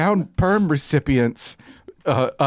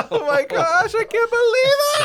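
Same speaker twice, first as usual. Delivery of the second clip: overjoyed squeal. Acted. Winner's overjoyed reaction.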